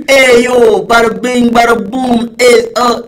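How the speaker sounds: loud, high, hammed-up New York Italian; trashy Jersey Shore Italian accent, shouted high; mock New York Italian accent. Shown as a demonstration. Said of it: "say it, do it again".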